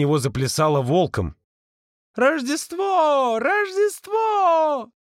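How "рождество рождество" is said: high, slow bleating sung cry; goat voice. Acted. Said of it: "Козлиным голосом пропел призрак".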